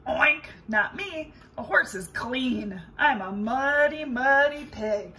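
loud oinking pig voice. Acted. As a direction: boom it out like a proud pig. Pig character speaks.

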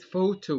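photo drawn out slowly in two clear parts; slow syllables of photo. Shown as a demonstration. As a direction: stretch photo into two beats. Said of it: "دي two syllables". (photo ببطء على مقطعين)

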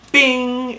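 a sharp, high-pitched cartoon bing; ping it out like a bell being struck; a bright little ding as the flower lands; high bing sound effect. Mimics flower hitting the letter.

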